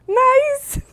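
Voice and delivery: high, loud squeal of approval; retold delight. Traveller discovering family there, retold as an excited squeal.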